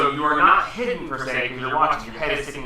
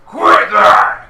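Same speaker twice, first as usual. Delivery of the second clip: giant's shout; 10 semitones higher, 13 dB louder than usual. Acted. Giant head scolds another.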